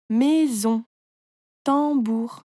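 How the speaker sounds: "maison", "tambour", each in two drawn beats; "maison" and "tambour" split into clear syllables. A narrator's reading. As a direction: stretch mai-son, then tam-bour. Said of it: "tu coupes le mot en petites parties".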